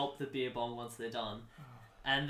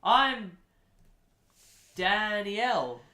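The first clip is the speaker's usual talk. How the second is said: higher, drawn-out words with long pauses; mum character; 5 semitones higher, 4 dB louder, slower than usual. Acted. Mum introducing herself to E T, slow character voice. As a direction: introduce yourself slowly, voice lifted. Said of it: "And then the mum goes".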